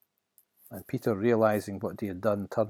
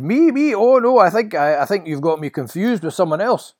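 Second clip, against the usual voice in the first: high, loud stammering protest; flustered denial; 10 semitones higher, 12 dB louder than usual. Acted. Peter to the passerby who recognised him, flustered. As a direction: protest innocence in a panic. Peter denies knowing Jesus.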